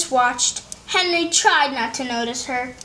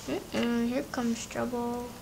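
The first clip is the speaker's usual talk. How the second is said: much softer, knowing warning; ominous aside; 4 semitones lower, 13 dB quieter than usual. Real, not acted. Reader warns the kids quietly about trouble ahead. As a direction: hush it like a secret warning.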